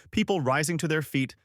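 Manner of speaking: ordinary delivery, nothing marked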